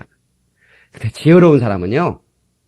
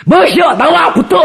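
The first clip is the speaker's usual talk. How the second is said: much higher, loud mock drunk yelling; drunken challenge shout; 5 dB louder, slower than usual. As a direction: pick a fight like a drunk. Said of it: "남자들은 막 술 한잔 해보면". (술 취해 시비 거는 높은 외침)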